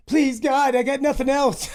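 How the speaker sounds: high, mock desperate plea to God; mock pleading. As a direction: beg the heavens, hammed-up. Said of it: "But then I started just".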